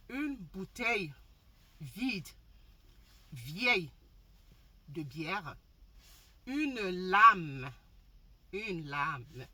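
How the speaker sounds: très lent, mot par mot; détacher chaque mot lentement; au ralenti. Real, not acted. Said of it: "je parle au ralenti". (parole au ralenti)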